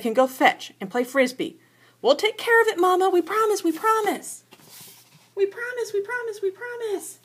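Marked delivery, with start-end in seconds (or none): 2.0-7.2 put-on high, eager pleading children's voice; high begging cubs. A narrator's reading. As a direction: beg like little kids promising.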